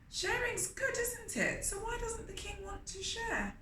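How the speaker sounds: put-on very high, teasing kids' voice; playful questioning. Narrator quizzing young listeners about sharing. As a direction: coax the children with a sing-song.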